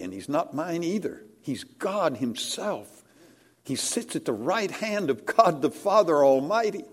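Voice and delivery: ordinary talk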